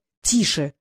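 hushing. A narrator's reading. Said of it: "цыкнул на неё Норт".

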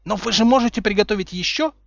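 high, pressing question; anxious insistence. A narrator's reading. Umbridge pressing Snape for potion, insistent. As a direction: press for it urgently.

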